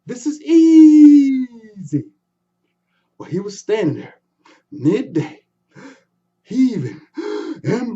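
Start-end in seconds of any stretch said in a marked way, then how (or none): 0.1-2.0 very slow strained "easy" shouted much louder. Acted. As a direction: yell it out in full effort.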